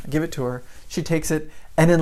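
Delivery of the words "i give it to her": ordinary talk, nothing marked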